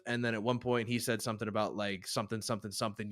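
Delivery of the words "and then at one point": ordinary talk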